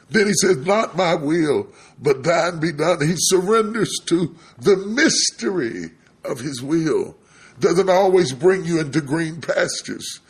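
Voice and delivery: high, loud pulpit proclamation; full-force preaching. Acted. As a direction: drive it home to the congregation.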